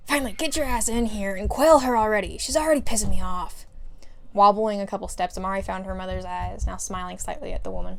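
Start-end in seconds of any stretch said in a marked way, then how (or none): 0.1-3.7 loud, irritated outburst; exasperated demand. Acted. Brother ordering his sister in, exasperated. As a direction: raise your voice in fed-up impatience.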